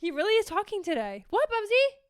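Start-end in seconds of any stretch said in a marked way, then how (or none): 1.3-2.0 high, loud playful question to the dog; playful call to Bubs; high pet talk. Real, not acted. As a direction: tease the dog sweetly. Asking the dog.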